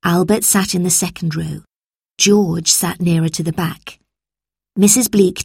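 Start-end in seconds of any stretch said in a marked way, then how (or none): none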